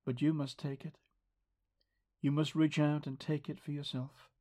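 ordinary talk, nothing marked

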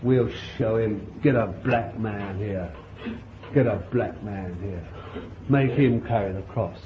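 low, quiet mocking order; sneering soldiers. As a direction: scoff and command with contempt. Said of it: "and said".

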